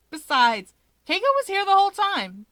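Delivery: high, loud, fast scoffing retort. Acted. Natsuo scoffing at his brother about a chaperone, high and fast. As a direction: toss it off with a scoff. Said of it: "Natsuo scoffs".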